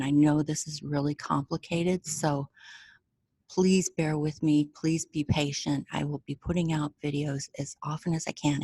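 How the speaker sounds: ordinary talk, nothing marked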